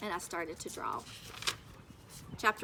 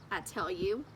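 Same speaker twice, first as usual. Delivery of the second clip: ordinary delivery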